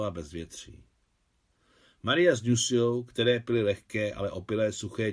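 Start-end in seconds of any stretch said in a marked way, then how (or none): none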